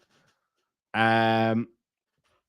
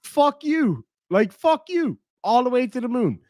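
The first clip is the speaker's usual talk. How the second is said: much higher, hammed-up scornful brush-off; defiant dismissal; 10 semitones higher than usual. Streamer voicing his imagined reply to the thief, high mock scorn. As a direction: fling it up high, dismissing him completely. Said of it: "I'm like".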